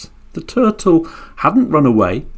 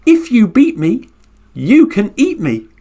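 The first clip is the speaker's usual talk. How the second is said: high little turtle voice; turtle's cheeky dare; 7 semitones higher than usual. Acted. Turtle daring the furious Garuda bird, high and cheeky. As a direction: dare the bird once more. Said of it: "the little turtle said".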